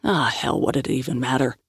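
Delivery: usual speaking voice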